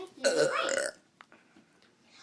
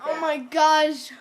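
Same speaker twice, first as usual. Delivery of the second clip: usual speaking voice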